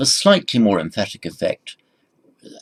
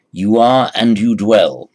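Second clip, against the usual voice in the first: ARE pushed out louder; stress on are; 7 dB louder than usual. Shown as a demonstration. Teacher stressing the verb for the class. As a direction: lean on "are" in "You are and you dwell". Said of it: "second person dual".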